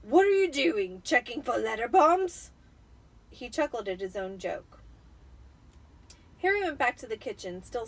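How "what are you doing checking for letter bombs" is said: louder, jeering gruff voice; mocking Uncle Vernon. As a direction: tease him like it's a great joke. Vernon jokes loudly.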